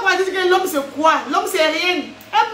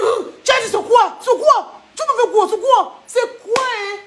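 put-on furious girlfriend; 5 semitones higher than usual. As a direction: shout down the boyfriend.

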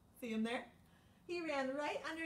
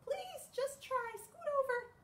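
mouse voice. Acted. Mouse begs the insects to let it in, high and squeaky. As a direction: beg like a tiny soaked mouse.

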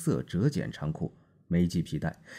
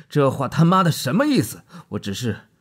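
much higher and louder, exploding at the insult; furious outburst; 9 semitones higher, 11 dB louder than usual. A narrator's reading. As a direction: blow up at him mid-sentence. Marlowe flares at an insult.

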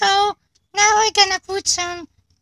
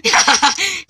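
delighted laugh; 2 semitones lower, 6 dB louder than usual. Real, not acted. Car starts moving.

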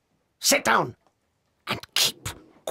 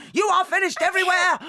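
fast loud high condemnation; raging headmistress condemning the girl; furious shout; 10 semitones higher, 3 dB louder, faster than usual. Acted. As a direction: hurl the verdict.

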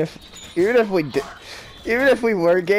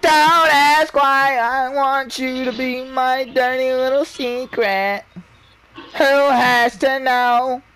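slow teasing sing-song; 6 semitones higher, slower than usual. Pretend. Seductive teasing slow line.

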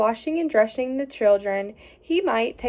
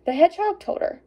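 ordinary delivery, nothing marked

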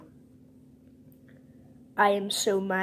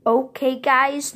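excited call; 6 semitones higher, 8 dB louder than usual. Pretend. Toy calls the group together.

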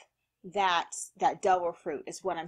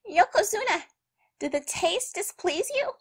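high character voice; 10 semitones higher than usual. Retold or imitated.

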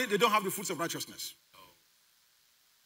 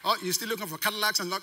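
ordinary delivery

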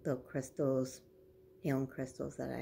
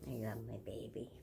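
soft whispered endearment; 3 semitones lower, 8 dB quieter than usual. Real, not acted. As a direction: breathe it to the dog. Whispering to the dog.

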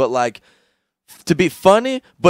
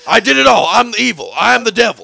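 mock Alex Jones rant; 7 semitones higher, 5 dB louder than usual. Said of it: "Alex Jones being like".

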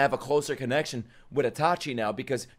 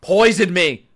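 loud, raised-pitch demand; shouted plea; 4 semitones higher, 7 dB louder, slower than usual. Pretend. Viewer reacting to the poison scene, shouted plea. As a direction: yell it out with mock desperation.

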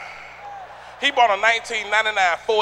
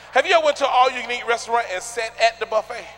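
usual speaking voice

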